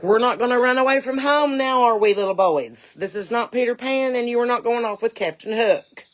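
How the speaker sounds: put-on high, loud scolding baby talk; talking to roosters. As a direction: scold them like naughty little boys. Scolding roosters laid out like children.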